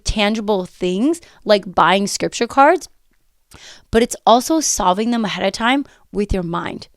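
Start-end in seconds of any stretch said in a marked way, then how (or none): none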